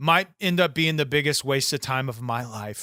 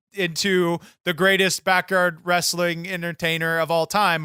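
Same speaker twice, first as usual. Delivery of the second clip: ordinary talk, nothing marked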